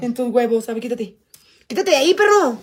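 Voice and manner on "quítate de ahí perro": bossy order. Real, not acted. Orders partner out of the way.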